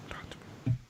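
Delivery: very soft whispered catchphrase; playful whisper. Pretend. Host handing over to co-host, playfully whispered.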